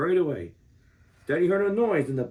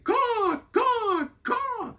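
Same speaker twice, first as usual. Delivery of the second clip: very high cawing crow call; crow caws; much slower than usual. A narrator's reading. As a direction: caw like a mother crow flying away.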